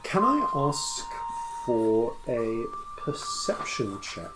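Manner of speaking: usual speaking voice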